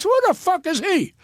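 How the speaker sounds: high, scornful mock outrage; indignant retort. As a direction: toss it off high and contemptuous. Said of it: "I said".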